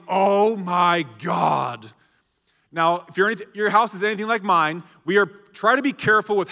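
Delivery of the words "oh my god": drawn-out mock "Oh my God"; slow, stretched teen exclamation; retold exclaim. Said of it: "exclaimed loudly".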